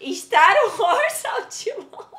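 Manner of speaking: slow, loud showman title call; announcing. Real, not acted. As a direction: announce it like a game-show host.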